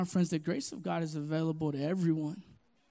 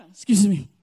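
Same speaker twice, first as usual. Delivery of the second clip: normal talking voice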